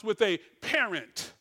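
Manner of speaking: "parent" set apart and leaned on; stress on parent. Real, not acted. Preacher recalling strict homes, heavy stress on "parent". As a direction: lean hard on the word "parent". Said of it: "and I emphasize parent".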